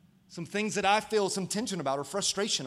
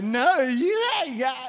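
slow, higher and louder mock sweet-talk; lovey voice; 6 semitones higher, 9 dB louder, slower than usual. Imitating himself as a smitten boyfriend, lovey. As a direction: coo it back, hamming it up. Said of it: "I was like".

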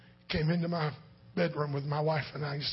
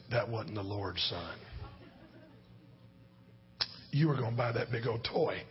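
lower, softer, a hint of a stern dad; lightly voiced dad correcting his son; stern father; 7 semitones lower, 5 dB quieter than usual. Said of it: "I said".

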